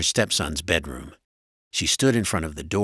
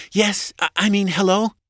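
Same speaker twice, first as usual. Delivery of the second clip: high, loud wife voice, caught off guard; high-pitched, rattled phone greeting; flustered wife; 6 dB louder than usual. A narrator's reading.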